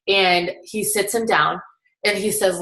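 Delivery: normal talking voice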